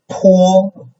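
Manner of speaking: PO held very high and level; high, steady PO; first tone. Shown as a demonstration. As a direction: say PO on one high, flat pitch. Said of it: "it's very level".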